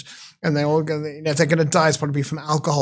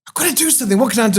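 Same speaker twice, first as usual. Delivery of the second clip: high, upset voice of a distressed former client; worried client; 7 semitones higher, 3 dB louder, much faster than usual. Retold or imitated. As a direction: play the anxious caller. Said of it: "and said".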